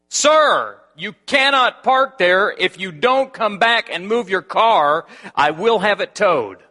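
high, loud and slow, every word hammered; loud threat. Retold or imitated. Guard loudly threatening to tow the pastor's car. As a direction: bellow the towing threat.

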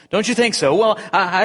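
high, loud flustered ghost. Acted. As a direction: stammer it in a fluster.

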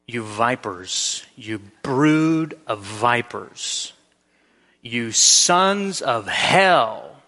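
low, very slow, deliberate denunciation; grave rebuke. Acted. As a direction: draw out each insult, building in volume. Preacher voices Jesus rebuking religious leaders.

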